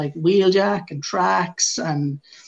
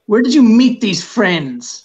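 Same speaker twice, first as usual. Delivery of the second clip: slow, louder, higher, interrogating; suspicious border officer; 6 semitones higher, 8 dB louder, slower than usual. Retold or imitated. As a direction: question it slowly like a suspicious official.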